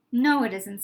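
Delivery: ordinary delivery, nothing marked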